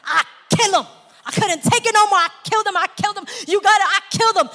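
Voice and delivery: high, loud hammed-up confession. Preacher acting a woman's movie confession, loud and high. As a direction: belt out the confession like a movie scene.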